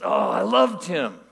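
high, loud, drawn-out delight; effusive retold enthusiasm; gushing welcome. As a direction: imitate someone adoring everyone they meet. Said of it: "Isaiah is just so welcoming".

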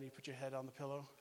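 ordinary talk

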